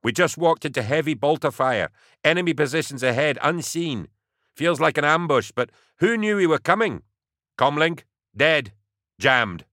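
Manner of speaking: brief the commissar fast and tense; tense field report. Acted. Soldier reports ambush to commissar.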